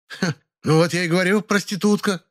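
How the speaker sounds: high, sneering taunt; mocking jab. A narrator's reading. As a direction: twist the knife mockingly. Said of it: "насмешливо продолжила". (насмешливая издёвка)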